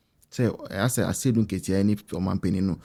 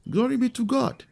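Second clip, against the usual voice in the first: high mock celebration; 13 semitones higher than usual. Mimicking the rival camp's triumphant praise, pitched way up.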